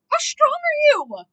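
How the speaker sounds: loud, high, drawn-out disbelief; amazed outburst. Real, not acted. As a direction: cry it out in sheer astonishment. Amazed reaction to character's strength.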